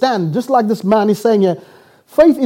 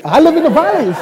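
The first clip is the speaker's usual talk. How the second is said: playful posh put-on, higher pitched; mock posh; 6 semitones higher, 3 dB louder, faster than usual. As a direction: put on posh airs with a hint of laughter. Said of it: "they think I'm posh".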